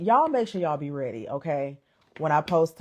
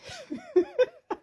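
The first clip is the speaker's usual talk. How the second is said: high bright laugh, trailing down; quick high giggle. Real, not acted. Laugh after greeting.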